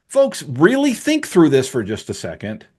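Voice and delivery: normal talking voice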